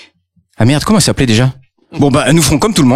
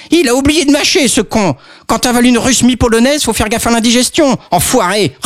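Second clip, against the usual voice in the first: put-on high, gloating old lady; tough granny voice; 9 semitones higher than usual. Grandmother with her shotgun, high gloating voice. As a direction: gloat over the dead wolf.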